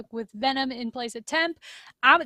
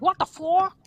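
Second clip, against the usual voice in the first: lightly attempted accent impression, higher and louder; half-baked jokey accent attempt, pitched up; bad Crim Six accent; 5 semitones higher, 5 dB louder than usual. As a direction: blurt it in a badly faked accent. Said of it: "I can't do a Crim Six accent".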